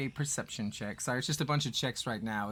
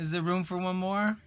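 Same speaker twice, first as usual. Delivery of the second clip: higher, louder satyr voice; wheedling satyr; 7 semitones higher, 5 dB louder than usual. Acted. Satyr asks the party for a place on the raft, high and hopeful. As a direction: ask to be let aboard, pitched up.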